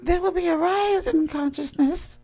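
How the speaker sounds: very high, loud squeaky channeled voice; silly falsetto spirit pronouncement; spirit guide voice. Pretend. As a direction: proclaim it as a high-pitched mock entity. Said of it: "my spirit guy gotta be talking like this".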